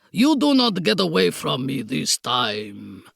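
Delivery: put-on low gloat in Bragislanian accent; gloating Count. A narrator's reading. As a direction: gloat as the triumphant foreign villain. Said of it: "the Count gloated".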